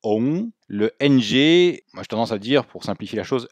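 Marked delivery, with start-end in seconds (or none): 0.0-0.5 "ong" higher, rising, no hard g; "ong" ending on a nasal hum; g dies in throat. Shown as a demonstration. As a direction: say "ong" letting the ng die away in the throat. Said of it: "ça meurt dans la gorge".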